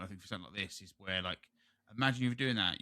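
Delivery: ordinary talk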